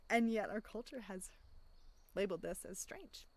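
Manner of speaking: ordinary talk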